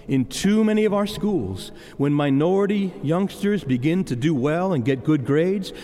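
usual speaking voice

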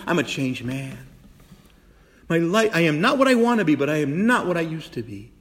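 ordinary talk

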